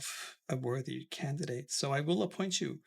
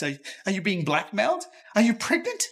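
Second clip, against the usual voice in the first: outraged questioning; 5 semitones higher, 8 dB louder than usual. Acted. Father accusing daughter, shocked outrage. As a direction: voice climbing higher and louder in outrage.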